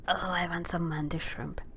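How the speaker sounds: soft covetous whisper. Real, not acted. Player eyeing a mantis shrimp, hushed whisper. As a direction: whisper it like a greedy secret.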